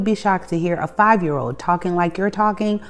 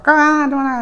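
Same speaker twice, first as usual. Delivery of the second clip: high, loud imitation of someone objecting; mock combative; 10 semitones higher, 6 dB louder than usual. Imitating people turned off by the advice, mock combative. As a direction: push back combatively, pitched up and loud. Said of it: "Some people are really combative with that".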